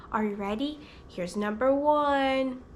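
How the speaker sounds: ordinary talk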